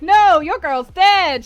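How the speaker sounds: blunt loud correction. Acted. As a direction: break bad news with comic bluntness, loudly. Friend blurts out the death, acted.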